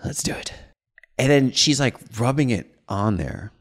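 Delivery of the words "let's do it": hushed nervous whisper. Retold or imitated. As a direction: breathe it out low and unvoiced. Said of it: "I was like".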